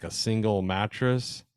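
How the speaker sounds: ordinary talk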